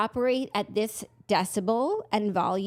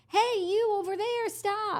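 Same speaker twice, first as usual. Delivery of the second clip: attempted yell; 12 semitones higher, slower than usual. Shown as a demonstration. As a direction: try to yell across a room at normal volume. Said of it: "'cause I can yell. But, like, if I need to".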